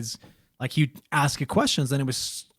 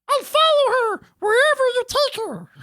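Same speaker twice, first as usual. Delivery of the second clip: very high, squeaky falsetto; eerie little critter in thin falsetto; creepy mongoose voice. Acted. Mongoose answering parents from inside the walls, high squeaky falsetto. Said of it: "they hear coming from the walls".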